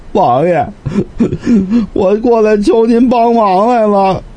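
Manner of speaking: high, sobbing petitioner's voice; tearful plea. Acted. Scholar kneeling before the lord to beg help, tearful. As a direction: break into mock sobs while begging. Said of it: "说着就哭了".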